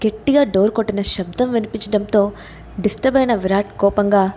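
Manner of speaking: ordinary delivery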